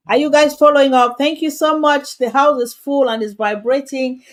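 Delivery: loud, high welcome to the live audience; loud excited call to the viewers; excited host. Real, not acted. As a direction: lift it up and call out to the crowd.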